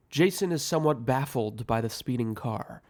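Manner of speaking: ordinary delivery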